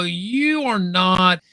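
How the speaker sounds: usual speaking voice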